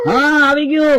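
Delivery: crying shout of a spirit arriving; sobbing ghost voice; acted weeping spirit. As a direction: wail the answer through tears. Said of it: "ચાલ, હવે રોવા માંડ".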